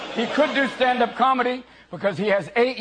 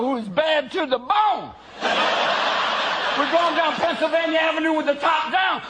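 hip-hop Obama; 5 semitones higher than usual. Retold or imitated. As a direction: hype the crowd like a rapper president. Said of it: "kicks it up a notch, going".

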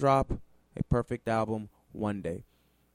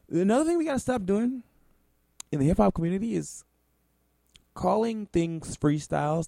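fast and higher, ranting; 8 semitones higher, faster than usual. Real, not acted. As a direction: rush it out in a rant. Rushed rant.

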